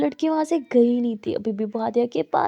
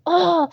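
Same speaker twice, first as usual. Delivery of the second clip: frustrated cry; 6 semitones higher, 5 dB louder than usual. Acted.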